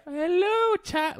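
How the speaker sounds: a hammed-up Southern belle hello; Southern woman accent. Showing his improv crutch, Southern woman accent. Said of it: "Southern woman accent".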